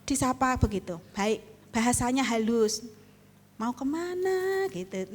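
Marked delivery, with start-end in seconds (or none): 3.6-4.6 high, hushed and slow; a soft-spoken wife greeting a passerby; soft gentle question. Retold or imitated. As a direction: ask sweetly and unhurried. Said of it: "Nadanya tuh yang lembut-lembut".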